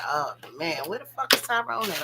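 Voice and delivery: low, fed-up grumble; impatient. Retold or imitated. Waiting for Tyrone, a low fed-up grumble. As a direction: sigh it out, bored of waiting. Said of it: "I'm like".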